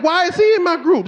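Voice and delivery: high-pitched, indignant mock complaint; mad. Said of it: "I was already mad".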